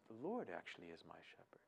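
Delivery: a soft, near-whispered reminder to yourself; quiet self-reminder. Shown as a demonstration. Preacher shows listeners how to remind themselves, softly murmured. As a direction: hush it inward, almost under the breath. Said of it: "you keep reminding yourself".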